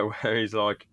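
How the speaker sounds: usual speaking voice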